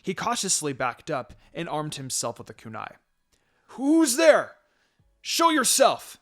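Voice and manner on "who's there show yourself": high, loud, scared shout into the dark; terrified shout at a hidden attacker; frightened shout. A narrator's reading. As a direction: yell out to hide the fear. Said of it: "he shouted".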